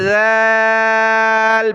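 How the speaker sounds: "ذال" begun with soft voiced th, held long; tongue tip past the teeth, stretch "ذال". Shown as a demonstration. Teacher models the letter, "ذال" with soft long th. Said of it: "زبان نکال کر نرمی سے ادا کرنا".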